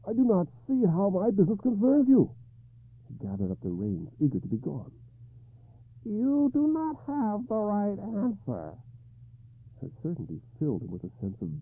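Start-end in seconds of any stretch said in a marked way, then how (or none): 0.1-2.3 higher, louder, dismissive; curt king. Acted. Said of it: "Arthur replied curtly". 6.0-8.7 high, slow crone voice; croaking hag voice. Acted. Lady Ragnall speaks.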